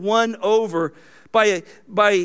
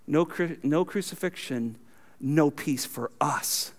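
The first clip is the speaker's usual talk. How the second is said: soft and slow, lower; sincere reflection; 5 semitones lower, 7 dB quieter than usual. Acted. Preacher turning the slogan into a believer's confession, softly and slowly. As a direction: let it land gently as a believer's truth.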